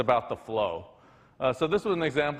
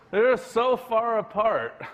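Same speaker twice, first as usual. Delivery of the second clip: stretched out, much higher and louder, every word leaned on; emphatic exasperation; 9 semitones higher, 4 dB louder, slower than usual. Real, not acted. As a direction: drag it out to show how absurd it is. Exasperated point about sentence structure.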